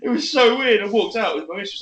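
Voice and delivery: ordinary talk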